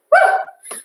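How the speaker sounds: high, loud whoop of encouragement; excited woo. Real, not acted. Instructor cheering on the class mid-workout, high whoop. Cheering during squat kicks.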